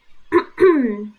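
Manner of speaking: a quick throat clear; short throat clearing. Real, not acted. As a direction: clear the throat before going on. Throat clearing between sentences.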